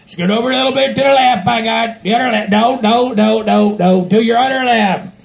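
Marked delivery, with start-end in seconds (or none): none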